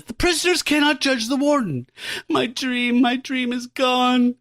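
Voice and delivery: hammed-up, high-pitched melodramatic meltdown; losing it. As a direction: wail it drawn out, as a mock breakdown. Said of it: "So he's losing his shit".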